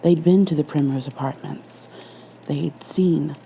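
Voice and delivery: ordinary delivery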